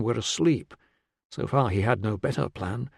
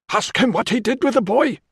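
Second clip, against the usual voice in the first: high-pitched cry from the crowd; accusing shout; 11 semitones higher, 3 dB louder than usual. A narrator's reading. Onlooker accusing a stranger before the crowd, shouting high. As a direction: shout it over the mob. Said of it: "shouted someone".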